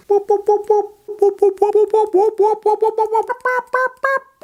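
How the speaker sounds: very high, rapid siren whoops; alarm whooping. Acted. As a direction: whoop like a warning meter going off. Imitated alarm of malarkey meter.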